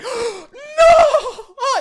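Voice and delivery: high, loud horrified gasp and cry; mock horror. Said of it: "He's like".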